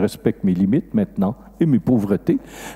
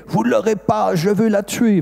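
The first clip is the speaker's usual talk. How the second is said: mother crying out; 9 semitones higher than usual. Retold or imitated. Mother refusing to give up the child, imitated high cry.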